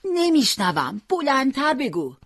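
high-pitched yell of someone hard of hearing; shouting old woman. A narrator's reading. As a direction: call out, demanding to hear. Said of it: "پیرزن داد زد".

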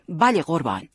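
earnest soldier's acknowledgement; very serious, military. A narrator's reading. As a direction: answer like a soldier taking an order. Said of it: "احترام نظامی گذاشت و خیلی جدی گفت". (خیلی جدی و نظامی)

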